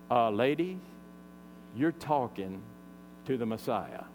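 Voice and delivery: low, quiet, with weighty pauses; measured revelation. Retold or imitated. Preacher voices Jesus revealing himself.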